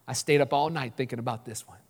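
low, soft deadpan; hushed, mock-serious delivery; solemn joke answer. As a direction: confide it quietly, as if deeply pondered.

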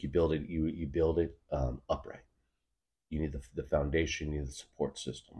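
calm lecturing brother. Retold or imitated. Quoting older brother explaining brewing.

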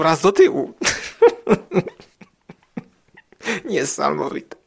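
delighted laugh through the words. Real, not acted. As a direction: laugh while you say it. Laughing at creature scene.

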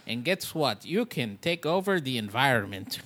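loud, slowed master-class lecturer in a put-on accent; hammed-up teacher's accent, a little like Andy Kaufman. Mock teacher welcoming a master class on cheating death, in a hammed-up accent. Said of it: "I don't know why I did that accent".

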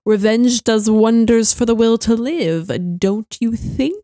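slow, menacing villain purr; Grand Inquisitor quote. Retold or imitated. Fan quoting the Grand Inquisitor's line, slow menace. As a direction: savor every word like a smug Inquisitor. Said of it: "is like".

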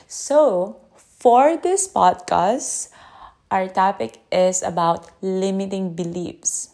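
usual speaking voice

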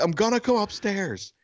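high-pitched calling voice; calling upstairs. Retold or imitated. Neighbor to unanswering wife upstairs, calling out. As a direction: imitate warning someone a floor above.

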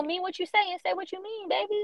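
high, sing-song comeback; pointed, high-voiced retort; sassy retort. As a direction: pitch it way up, sweetly scolding. Retorting to an excuse.

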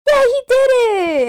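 very high, slow cheering shout; mock cheer. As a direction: cheer like a thrilled crowd. Crowd cheering Junior.

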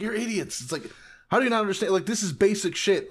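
hammed-up exasperated lecture; exasperated scolding. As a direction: scold them as clueless. Voicing Nami scolding the crew.

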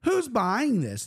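high, slow, drawn-out mock disgust; hammed-up grossed-out who's buying this; TikTok guy impression. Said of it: "There's a guy on TikTok that always does that".

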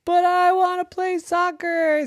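high, pleading whine; whiny Jenny. Acted. Jenny pleading to keep playing, high and whiny. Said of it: "said Jenny".

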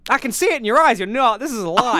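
high, loud hammed-up accusing; mock accusation. Accusing the host of lying, hammed-up. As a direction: play the wronged detective.

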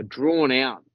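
drawn-out. Retold or imitated. Explaining the growl to friends, drawn-out. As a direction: draw the words out like the growl.

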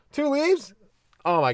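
leaves jumping way up, astonished; hammed-up disbelief at two leaves; mock amazement. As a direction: squeak it like it's a miracle. Mock amazement at desert tree.

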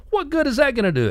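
very high, alarmed comic voice; protesting cartoon guy. Balloon buyer complaining as he floats off, mock panicked protest. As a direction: whine it high in mock distress.

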